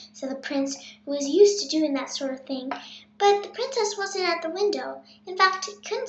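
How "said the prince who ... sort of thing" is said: ordinary delivery, nothing marked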